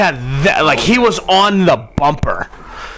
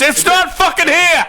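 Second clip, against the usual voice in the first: high-pitched retold yell; mock irate reply; 13 semitones higher than usual. Man answering tow guy at the door, mock angry yell. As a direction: imitate an exasperated man hollering back, pitch way up.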